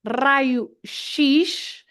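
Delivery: "raio-x" said RYE-oo sheesh, slow with a lifting end; Portuguese pronunciation. Shown as a demonstration. Teacher sounding out "raio-x" for English learners, Portuguese pronunciation. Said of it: "that's the letter X in Portuguese".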